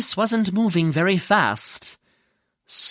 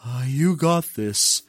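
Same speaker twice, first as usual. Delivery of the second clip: low, measured tortoise voice; steady yourself quietly, slowing to the end; calm pep talk to oneself, pitched low; Tortoise's self-encouraging mantra; 6 semitones lower, 3 dB quieter than usual. Acted. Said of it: "Tortoise just repeated over and over".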